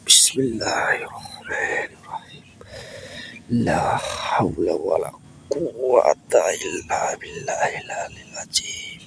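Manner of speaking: slow, breathy Arabic prayer under the breath; murmured healing prayer; whispered prayer. Real, not acted. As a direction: whisper the prayer slowly over him. Prayer recited over sick man.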